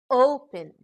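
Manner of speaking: "open" with O punched loud; "open" demonstrated with strong O, lighter end; stress on first syllable. Shown as a demonstration. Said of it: "stress is given on the first syllable".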